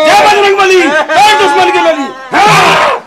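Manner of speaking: loud, slow war chant; battle cry. Acted. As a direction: roar it like charging into a fight. Battle cry before fighting. (Bajrang बली का जोशीला नारा)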